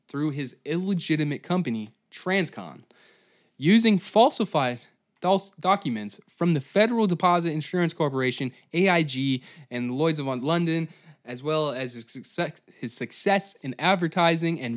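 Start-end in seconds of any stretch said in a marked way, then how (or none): none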